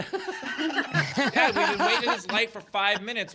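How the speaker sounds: high, loud, mock-exasperated child; impatient kid complaint. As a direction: whine up at Dad, laughing through it. Acting out impatient kid in car.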